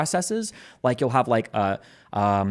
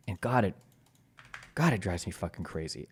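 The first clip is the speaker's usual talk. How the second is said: ordinary talk, nothing marked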